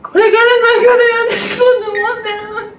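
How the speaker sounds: fast, loud, tearful wail; snotty crying. Acted. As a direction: blubber it out through fake tears. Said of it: "泣くこと、涙を流す".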